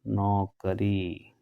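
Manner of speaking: नौकरी drawn out very slowly, syllable by syllable; slow spelled-out word. Shown as a demonstration. Teacher to students, drawing out नौकरी very slowly. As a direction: say नौकरी slowly so students catch each sound. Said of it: "क्या होती है बेटा?".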